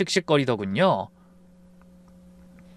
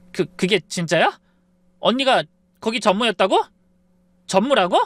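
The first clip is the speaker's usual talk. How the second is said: stunned disbelief; 6 semitones higher, 4 dB louder than usual. Acted. Stunned daughter stammering.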